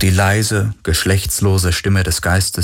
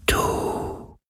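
very slow, breathy wind whisper; faintest whisper; 8 dB quieter, much slower than usual. A narrator's reading. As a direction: stretch each word into a breath of wind. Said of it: "so leise wie der Schatten des Hauchs eines Flüsterns".

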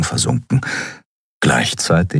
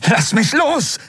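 put-on much higher, urgent struggling young man; Kai struggling. Kai twists in Kero's grip, high urgent voice. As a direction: demand release in a struggle.

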